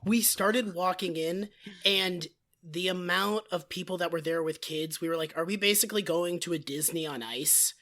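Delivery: raspy blown-out voice; hoarse voice. Real, not acted. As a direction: talk with a wrecked throat. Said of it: "husky, raspy, sexy voice".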